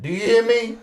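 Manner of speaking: ordinary delivery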